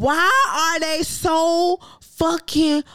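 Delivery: high, loud, drawn-out disbelieving whine; exasperated rant. Real, not acted. Host venting about old government officials, exasperated loud whine. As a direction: cry out at how absurd it is.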